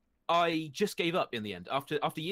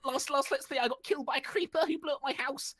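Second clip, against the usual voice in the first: put-on much higher, bouncy kid-gamer intro voice; hyper Let's Play YouTuber; 13 semitones higher than usual. Mocking his old Let's Play intros to the interviewer, high and bouncy. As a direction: hype it up like an over-eager Minecraft streamer. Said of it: "it's like you go third person".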